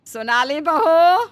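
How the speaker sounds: slow, loud call across the house. Acted. Mother-in-law calls the sleeping younger bahu, loud. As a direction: call her name loudly, rising.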